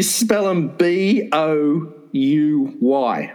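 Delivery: each letter slow and deliberate; slow spelling out. Shown as a demonstration. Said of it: "That's what your secret group calls them".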